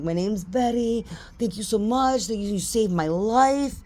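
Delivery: high-pitched, hammed-up gratitude; gushing thanks. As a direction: gush at your rescuer, playing it up. Acts out gushing thanks to lifeguard.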